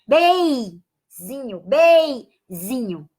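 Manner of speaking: "beizinho" said very slowly, the N heard as an I glide; slow sounded-out word. Shown as a demonstration. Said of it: "o N tem um som de I". ("beizinho" devagar, com som de I no lugar do N)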